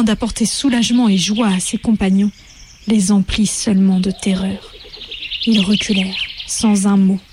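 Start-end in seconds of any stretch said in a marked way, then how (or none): none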